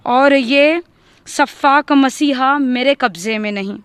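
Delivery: ordinary delivery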